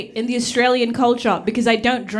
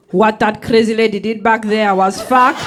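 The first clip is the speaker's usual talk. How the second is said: louder, mock-innocent mom voice; mom switching tone; 7 dB louder than usual. Single mom comforting her kid, in a louder mock-innocent voice. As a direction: play the mom pretending someone else did it. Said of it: "Five minutes later".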